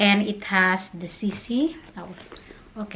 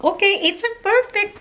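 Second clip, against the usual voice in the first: shrieking jackpot winner; 11 semitones higher, 5 dB louder than usual. Real, not acted. Bag fits perfectly.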